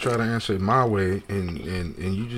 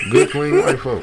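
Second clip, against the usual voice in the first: louder hammed-up mock; 6 semitones higher, 7 dB louder than usual. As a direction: play it up, teasing the crew. Repeated louder mocking.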